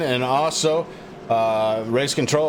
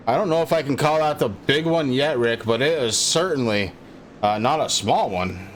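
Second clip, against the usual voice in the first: ordinary talk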